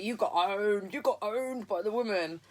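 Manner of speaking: a light voiced mocking teenage girl; teasing schoolgirls. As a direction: taunt him, gleeful and sing-song.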